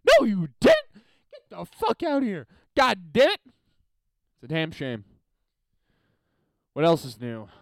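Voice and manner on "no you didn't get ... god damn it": high, loud scornful rant; mock outburst. Imagined rant at people taking credit, high angry outburst. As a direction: tear into the bragger. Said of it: "It's like".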